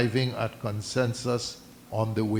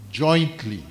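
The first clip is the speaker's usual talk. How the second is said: jointly said louder with a falling tune; stress on jointly; 6 dB louder than usual. Shown as a demonstration. Reading the agreement to the meeting, punching jointly. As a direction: lean hard on jointly. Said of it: "and I emphasize the word jointly".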